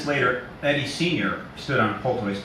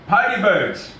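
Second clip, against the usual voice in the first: high, loud big simple man; simple Eddie; 10 semitones higher, 6 dB louder, slower than usual. Acted. Narrator voicing simple Eddie to the kids, high and loud. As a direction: boom it out like an eager childlike giant.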